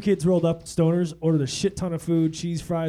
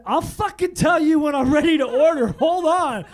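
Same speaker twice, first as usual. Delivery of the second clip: high, loud angry snap at the waiter; angry outburst; 10 semitones higher, 6 dB louder than usual. Retold or imitated. Snapping at the pushy waiter to back off, loud and angry. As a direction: bite his head off, loud and high.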